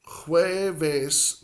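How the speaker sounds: jueves opened on a breathy H, HWEH-ves; soft Spanish J. Shown as a demonstration. Spanish teacher introducing Thursday's word, soft J sound. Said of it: "has a soft sound".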